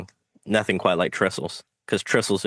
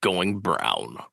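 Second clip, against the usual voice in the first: lightly voiced military radio call; a hint of a soldier reporting in; military style; 2 semitones lower than usual. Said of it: "in a kind of military style".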